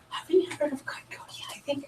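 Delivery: hushed aside to husband; whispering. Retold or imitated. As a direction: whisper it behind your hand.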